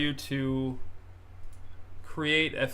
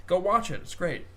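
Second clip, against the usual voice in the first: normal talking voice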